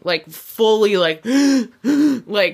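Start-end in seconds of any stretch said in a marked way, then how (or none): 1.3-1.8 sharp high gasp for air; panic gasp. Retold or imitated. Reenacting her panic attack, a sharp gasp. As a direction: gasp like you can't breathe.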